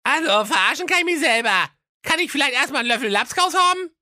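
high cartoon prince, quick and cheeky; high goofy prince asking for stew. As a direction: brush it off high and fast. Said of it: "antwortete der Rocco".

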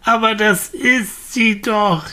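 put-on high, slow, emphatic child's insistence; boy crying out. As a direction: call it out as if obvious. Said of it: "rief der Junge". (Junge, der beteuernd ruft)

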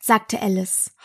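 ordinary delivery

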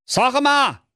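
very high comic bawl; squawking outrage. Acted. Jakob into the phone receiver, high angry squawk. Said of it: "krakeelte der Jakob in den Hörer".

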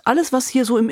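ordinary talk

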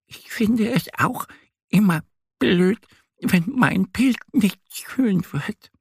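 very high duck voice, measured; little duck confiding. A narrator's reading. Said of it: "atmet langsam ein und aus und sagt".

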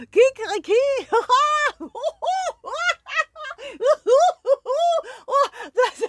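rooster crowing through laughter; laughing rooster. Acted. The rooster laughs at his own punchline, crowing. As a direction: crow and cackle at your own joke.

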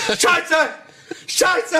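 hoher gespielter Schreck; nachgespielter Aufschrei. Retold or imitated. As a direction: spiel den Schreck noch mal nach. Missgeschick nachgespielt. (nachgespielter hoher Aufschrei)

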